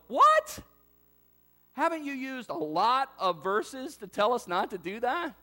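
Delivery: loud, high-pitched incredulous question; shocked objection. Acted. Baffled listener objecting to Paul's command, incredulous. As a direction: burst out in disbelief, then protest. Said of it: "did you hear that and go".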